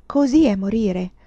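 ordinary delivery, nothing marked

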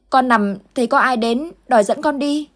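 put-on a scared kid trying to explain a nightmare; panicked child. Boy telling Uncle Tư his dream, panicked child voice. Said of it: "thái độ hết sức hoảng loạn".

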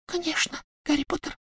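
very high, soft elf whisper; squeaky elf whisper. A narrator's reading. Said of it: "прошептал Добби".

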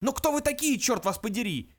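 very high, fed-up demand; exasperated, high-pitched question; exasperated outburst. A narrator's reading. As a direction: burst out, patience gone. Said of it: "не выдержал Фук".

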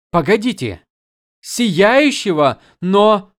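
high, loud startled interruption; startled outburst. Acted. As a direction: cut in, suddenly alarmed. Shalan interrupts, startled by the word.

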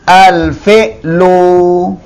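al-fi'lu drawn out very slowly; teacher reads al-fi'lu for the class; slow model reading. Shown as a demonstration. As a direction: stretch al-fi'lu syllable by syllable. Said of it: "tapi kalau pakai alif lam".

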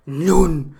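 sudden very loud burst; lunging teacher imitation. Mimicking the video lady lunging at the camera, explosive shout. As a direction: lunge at the listener with one explosive word. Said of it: "se acerca como serpiente hacia la cámara".

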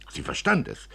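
usual speaking voice

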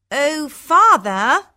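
high, drawn-out coaxing call; wheedling daughter. Acted. Hortensia asking her father for gifts, wheedling.

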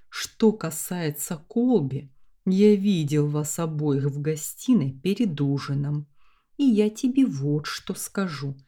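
ordinary delivery

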